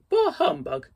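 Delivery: high, loud scoffing bah humbug; scornful Scrooge. Acted. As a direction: spit out the scorn like a miser. Scrooge's catchphrase dismissing Christmas.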